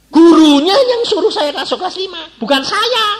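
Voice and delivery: high, loud comic outburst; indignant protest. Retold or imitated. Pupil protests the teacher chose the class, indignant.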